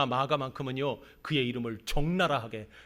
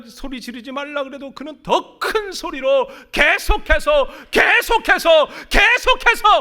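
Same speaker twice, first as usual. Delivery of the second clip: rising louder and higher on 계속해서; preaching at full force; 11 semitones higher, 12 dB louder than usual. Acted. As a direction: build to a shout on each 계속해서. Preaching builds to climax. (열정적으로 외치는 설교)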